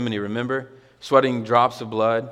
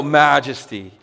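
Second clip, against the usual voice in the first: louder cry of praise; yelled majesty; 2 semitones higher, 6 dB louder, slower than usual. Shown as a demonstration. Preacher urging church to cry out, loud shout. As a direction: shout it out in awe. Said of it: "You wanna yell".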